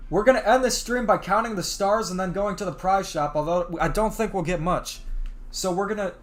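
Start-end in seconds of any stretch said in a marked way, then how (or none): none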